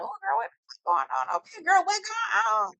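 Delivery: high, hushed mock eavesdropper; high-pitched, softly nosy "ooh girl" voice; nosy guests. Guests catching wind of an argument, nosy high voice.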